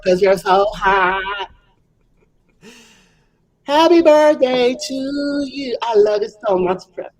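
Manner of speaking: put-on sing-song Valley Girl, slow and drawn out; ditzy Valley Girl singing. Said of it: "in my Valley Girl impression".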